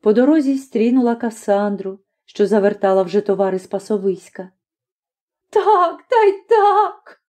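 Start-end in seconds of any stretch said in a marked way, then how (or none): 5.5-7.2 high, tearful lament; plaintive high wail; sorrowful recounting. A narrator's reading. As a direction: pour out the grievance tearfully. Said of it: "оповідала з жалем".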